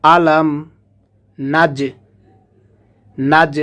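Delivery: নাজ cut short with a bouncing echo on the jim; qalqalah on jim. Shown as a demonstration. Teacher showing the echoing jim of নাজ. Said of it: "জিমটা কলকলার হরফ, এখান থেকে পাল্টা আওয়াজ হবে".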